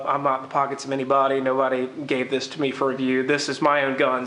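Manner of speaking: normal talking voice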